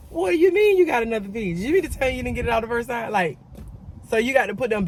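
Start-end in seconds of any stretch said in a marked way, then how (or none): 0.1-2.5 high, loud disbelief, replayed from memory; retold shocked cry; shocked outburst. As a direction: blurt it out like you can't believe her ears. Retold outraged reaction to dentist.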